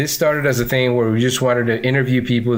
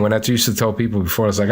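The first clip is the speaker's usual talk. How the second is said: ordinary talk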